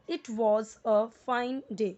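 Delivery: it was a fine day, each word dragged out slowly; wrong slow reading. Shown as a demonstration. Teacher showing the wrong, word-by-word reading. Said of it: "ऐसे नहीं पढ़ना है".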